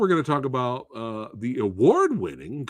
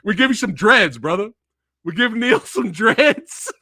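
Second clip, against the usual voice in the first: laughing through a silly joke; high giggly words between laughs; 9 semitones higher, 5 dB louder than usual. Real, not acted. Joking about Neil in dreads.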